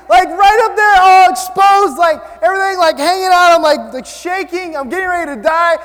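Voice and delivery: high, loud, hammed-up outrage; indignant rant. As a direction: shout the grievance as if still up the ladder. Indignant rant about being laughed at.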